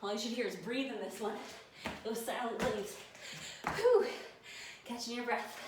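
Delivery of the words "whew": high, forceful puff of effort; loud exhaled whew. Real, not acted. Trainer catching breath during burpees, loud whew. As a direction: blow out hard mid-exercise.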